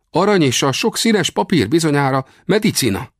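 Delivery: very high warrior voice; high-pitched, emphatic character voice; Comanche warrior, explaining. Acted.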